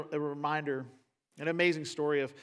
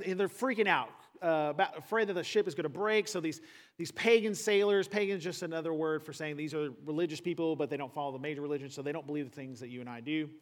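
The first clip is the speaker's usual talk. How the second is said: usual speaking voice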